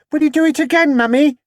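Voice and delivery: put-on eager little boy asking; small boy. A small boy asking his mother, high and excited.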